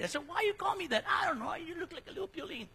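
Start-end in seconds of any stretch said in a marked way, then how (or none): 0.3-1.0 puzzled retold question. Retelling exchange with his child. 1.1-2.7 high, soft imitation of a child; child's reply. Voicing his child's reply.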